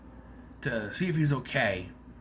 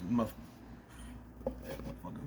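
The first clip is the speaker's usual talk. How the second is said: whispered swear; 3 semitones lower, 13 dB quieter than usual. Real, not acted. Vlogger whispering the swear substitute to viewers, whisper. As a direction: whisper the word under the breath.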